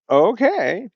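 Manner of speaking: high, resigned okay; reluctant okay. Retold or imitated. Conceding to daughter about the dog game, high and resigned. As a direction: give in with a high sigh.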